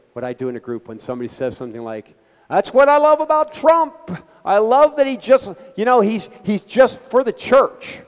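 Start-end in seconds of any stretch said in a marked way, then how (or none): 2.5-8.0 high, loud mocking voice of a Trump fan. A relative at Thanksgiving gushing about Trump, mocked high and loud.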